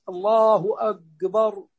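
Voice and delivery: slow أكبر with a hard g-like qaf for the k; kaf turned qaf. Shown as a demonstration. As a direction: say أكبر as "agbar", drawn out. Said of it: "كما لو أبدل الكاف قافا". (نطق كاف "أكبر" قافا)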